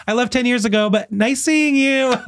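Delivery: very high, breezy singsong goodbye; cheery brush-off. Retold or imitated. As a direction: decline brightly, stretching the farewell.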